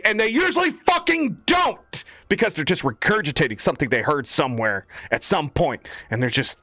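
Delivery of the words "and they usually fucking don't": higher, slower, leaning on fucking; ranting shout at stupid people; frustrated outburst. Real, not acted. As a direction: explode on the word fucking. Frustrated rant peak.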